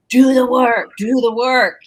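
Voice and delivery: high, loud barked order, imitating her teacher; retold yelling. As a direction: shout it like a drill coach pushing you. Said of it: "he's yelling at me".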